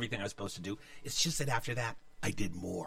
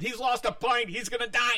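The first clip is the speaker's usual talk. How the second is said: panicked classmate; 11 semitones higher than usual. Retold or imitated.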